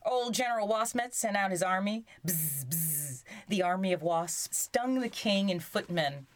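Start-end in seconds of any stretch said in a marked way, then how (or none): 2.2-3.2 soft imitated buzz; wasp buzzing. A narrator's reading. Narrator imitates the wasp army setting out, buzzing. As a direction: make two soft, buzzing z sounds.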